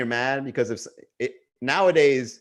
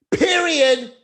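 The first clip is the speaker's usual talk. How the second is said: much higher and louder, a big final word; high loud emphatic period; 13 semitones higher, 7 dB louder than usual. Acted. Closing his dad joke point, a hammed-up loud high declaration. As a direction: slam it home, mock-dramatic.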